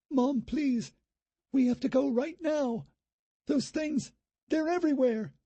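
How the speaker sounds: put-on high girl's voice, urgent and scared; a daughter hurries her mom away; frightened young girl. A narrator's reading. Daughter urging her mother to flee the zombies, high and scared.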